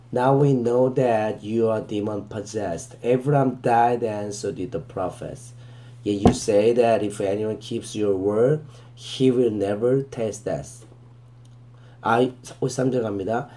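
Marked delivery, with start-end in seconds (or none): 0.1-10.9 very slow, deliberate verse reading, word by word; slow scripture reading. Shown as a demonstration. Teacher reading the verse to students, very slow and deliberate. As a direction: read it out slowly so students can follow.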